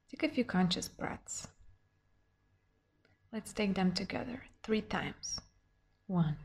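hushed and soothing, gently guide them inward; guided meditation. Acted.

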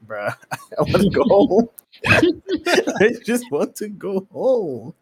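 high, drawn-out whine breaking into laughter; laughing mock despair. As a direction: whine it slowly while cracking up. Retold despair at bathroom mishap.

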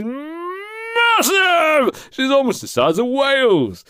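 long, high, drawn-out holler; mock-epic cry of huge size; exaggerated shout. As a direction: stretch it out and send it soaring. Exaggerating her sudden fame.

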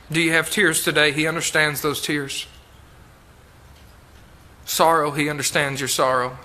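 soft, consoling sermon appeal; tender pastoral tone. Real, not acted. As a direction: speak gently to those grieving.

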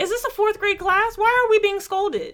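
high-pitched incredulous questioning; indignant protest. Acted. Customer protesting the owner's scolding, high indignant protest.

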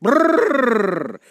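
shivering. Pretend. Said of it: "I got the chills".

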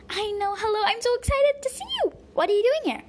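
high, loud gushing delight; excited. Acted. Granddaughter greets her grandpa, high and thrilled. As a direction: bubble over with excitement at seeing him.

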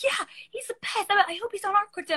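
high-pitched, excited fangirl gushing; gushing teammates. Retold or imitated. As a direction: imitate teammates raving about their favorite coach. Teammates gushing about the coach.

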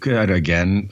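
normal talking voice

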